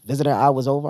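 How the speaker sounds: ordinary talk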